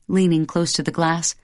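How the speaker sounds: usual speaking voice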